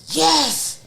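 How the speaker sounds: high loud cheer on yes; excited yes. Retold or imitated. Boyfriend on the phone cheering that she quit, high excited.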